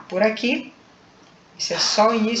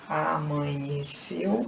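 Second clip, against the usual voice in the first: "amanheceu" drawn out slowly, lower in pitch; slow syllable-by-syllable; 4 semitones lower, 3 dB quieter, much slower than usual. Shown as a demonstration. Teacher segmenting "amanheceu" for students, slowly syllable by syllable. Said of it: "quando pronunciamos lentamente".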